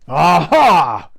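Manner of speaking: loud, high gloating troll cry; greedy troll, delighted; troll exclaiming. Acted. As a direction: pounce on it with glee. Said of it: "exclaimed the troll".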